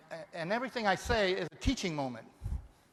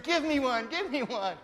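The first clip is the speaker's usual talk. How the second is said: higher, louder mock plea; imitating a grabby woman; 5 semitones higher, 4 dB louder than usual. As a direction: ham up a greedy begging woman. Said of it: "she's saying".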